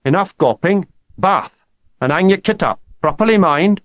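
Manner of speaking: put-on high, loud Welsh coach ordering boys; Welsh accent. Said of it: "He could turn his Welsh accent on".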